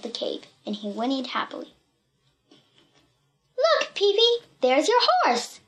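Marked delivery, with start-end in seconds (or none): 3.6-5.6 put-on high-pitched excited child; excited cry. A narrator's reading. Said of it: "cried T-, Timmy".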